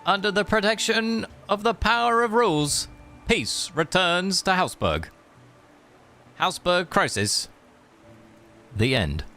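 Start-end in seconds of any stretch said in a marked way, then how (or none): none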